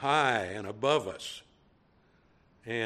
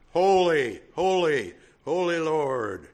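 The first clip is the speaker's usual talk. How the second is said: loud, raised, drawn-out proclamation; calling out; 3 semitones higher, 7 dB louder than usual. Acted. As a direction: call it out like seraphim to one another. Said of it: "One called to the other".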